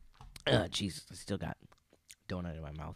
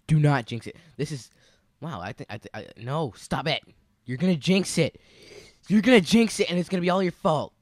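building from firm to high yelling; rising frantic scolding; frantic shout; 7 semitones higher, 13 dB louder than usual. Real, not acted. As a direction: panic at the jinx. Escalating panic.

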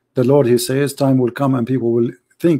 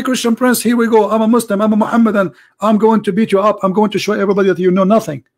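cocky taunting challenger, pitched up; mock caller challenge; 7 semitones higher, faster than usual. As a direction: throw down the gauntlet like a boastful caller.

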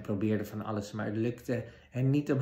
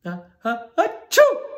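building sneeze bursting out very high and loud; 11 semitones higher, 12 dB louder, slower than usual. Acted. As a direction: let a big dwarf sneeze explode. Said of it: "moest een van de dwergen zo hard niezen".

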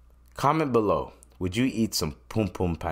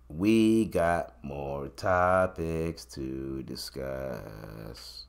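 slow drawl; 7 semitones lower, 7 dB quieter, much slower than usual. Pretend. Slow drawn announcement.

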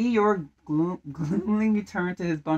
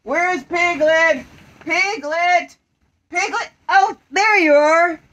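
calling out; 9 semitones higher, 7 dB louder, slower than usual. Acted. Calling for Piglet.

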